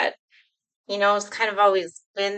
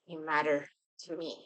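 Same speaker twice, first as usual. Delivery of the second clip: much softer, tender and close; soft reassurance; 4 semitones lower, 9 dB quieter than usual. Acted. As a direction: comfort them in a near-hush.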